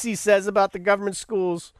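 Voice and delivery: ordinary talk, nothing marked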